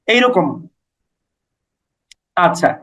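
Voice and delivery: usual speaking voice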